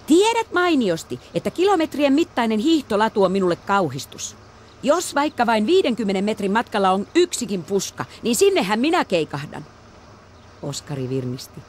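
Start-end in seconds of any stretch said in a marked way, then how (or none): 0.1-9.7 put-on high, loud girlish protest; young girl protesting skiing; girl's protest. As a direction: whine about the ski trail. Elisa voiced, protesting.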